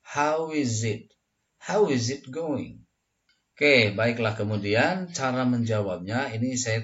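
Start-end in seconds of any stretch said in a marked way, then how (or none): 0.0-1.1 higher, slow "How is it" as "howizit"; three words joined. Shown as a demonstration. Teacher demonstrates for learners, three words joined. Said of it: "Jadi yang tiga ini disatukan". 1.6-2.9 higher, slow "How is it going" as "howizit going"; three words joined. Shown as a demonstration. Teacher models whole question, three words joined. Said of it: "Jadi yang tiga ini disatukan".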